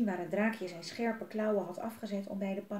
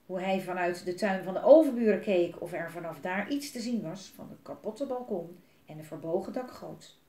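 ordinary delivery

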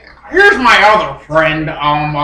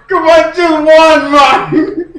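loud, high, put-on Jamaican accent; 6 semitones higher, 5 dB louder than usual. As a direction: push it like a hollering comic Jamaican. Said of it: "He's from Jamaica".

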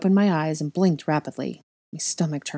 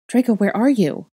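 higher, louder worried call; anxious gasp; 6 semitones higher, 6 dB louder than usual. A narrator's reading. Disoriented girl searching for Draco, raised alarmed gasp. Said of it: "I gasped".

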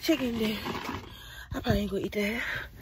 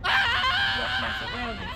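high squeal; 5 dB louder, slower than usual. Pretend. Mock shout, laughing.

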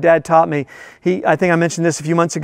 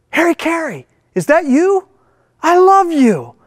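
starstruck thug; 10 semitones higher than usual. Retold or imitated. Thug recognizes famous announcer.

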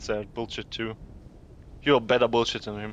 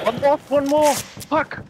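high, loud frantic shouting; excited shout; 6 dB louder, slower than usual. Real, not acted. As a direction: yell in the heat of the clutch. Clutch moment, then losing.

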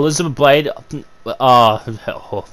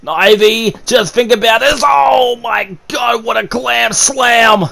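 very high, drawn-out shouted call; screaming commentator; 7 semitones higher than usual. Real, not acted. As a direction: scream it like the match just broke open. Commentator screaming at huge move.

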